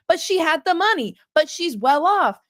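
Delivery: high pitched mock whine of the defendant; whiny excuse. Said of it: "She's like".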